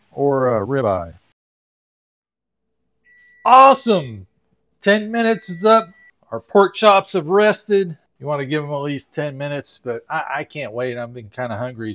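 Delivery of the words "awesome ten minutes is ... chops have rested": loud, high, eager exclamation; excited. Real, not acted. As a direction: burst back in thrilled the food's ready. Excited return to finished food.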